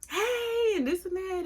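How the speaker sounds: a lightly hammed-up cheery hello; imitating mom's greeting. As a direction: mimic how mom greets the favoured sister. Mom greeting sister, imitated.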